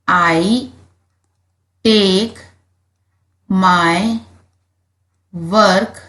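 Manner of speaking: I, take, my, work, seriously with long pauses between; dictation pace. Shown as a demonstration. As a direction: dictate slowly word by word. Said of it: "आप यहाँ लिखेंगे when".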